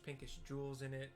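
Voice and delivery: ordinary delivery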